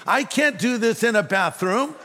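high-pitched mock protest. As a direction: act out an exasperated objection. Retold protesting thought.

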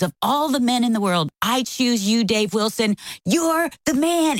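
building mock cheer, peaking high. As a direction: imitate a wife cheering on her husband. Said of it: "And you're cheering [name]".

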